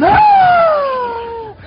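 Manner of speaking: put-on very high, loud child's wail; bawling wail. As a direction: let out a child's bawling cry. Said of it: "he comes in bawling".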